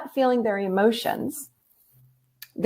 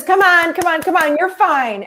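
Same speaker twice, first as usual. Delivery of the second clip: imitated mother-in-law, pitched up and hurrying; brisk dismissive urging; 7 semitones higher than usual. Mother-in-law to a crying toddler who fell, dismissive urging. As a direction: brush off the tears in a higher voice.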